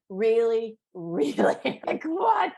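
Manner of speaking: half-laughing, half-crying disbelief. Retold or imitated. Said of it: "I'm like".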